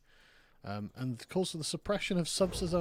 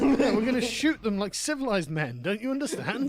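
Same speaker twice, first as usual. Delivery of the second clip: higher, louder, laughing through it; laughing bravado; 7 semitones higher, 8 dB louder than usual. Real, not acted. Host laughing at his own joke about shooting French boats, laughing. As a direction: ham up the threat while cracking up.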